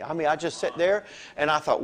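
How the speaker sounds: usual speaking voice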